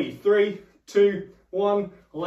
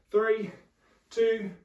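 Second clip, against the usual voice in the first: ordinary talk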